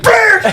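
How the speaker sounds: high, loud puppet blurt; a sudden nonsense burst; angry puppet noise; Swedish Chef gibberish. Retold or imitated. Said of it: "the Swedish chef is like".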